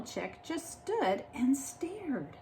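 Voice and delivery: hushed whisper; soft awed whisper. Acted. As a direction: whisper it in awe. Chicks stare in awe.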